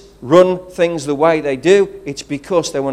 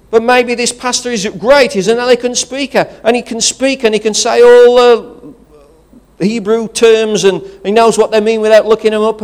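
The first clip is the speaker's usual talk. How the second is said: louder, higher, driving preacher's build; preaching at full force; 5 semitones higher, 6 dB louder than usual. Acted. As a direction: preach it out to the whole congregation.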